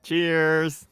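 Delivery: high, loud cheer; loud toast. Real, not acted. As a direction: raise a glass and call it out. Toast call.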